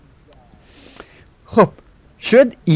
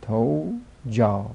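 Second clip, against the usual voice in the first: slow repeat; 7 dB quieter, much slower than usual. Shown as a demonstration.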